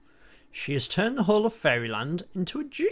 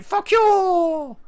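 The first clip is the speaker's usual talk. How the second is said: much higher and louder cartoon yell; train's rude retort; 8 dB louder than usual. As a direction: squeal it like a cheeky toy train. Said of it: "Train's just like".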